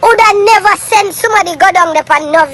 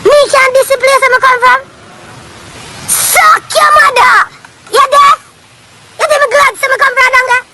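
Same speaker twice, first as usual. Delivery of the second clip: high, strained angry shouts; heated yelling; 7 semitones higher than usual. Real, not acted. Venting about where he comes from, heated yelling. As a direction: let the disgust boil over. Ranting about his home country.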